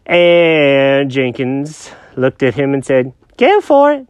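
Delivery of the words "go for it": high-pitched confused cat; squeaky cartoon cat, lost and puzzled. Jenkins voice.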